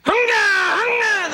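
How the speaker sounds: loud, high engine roar; explosive imitated ignition noise; roaring engine imitation. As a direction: shout out a car engine firing up. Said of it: "he turned the key".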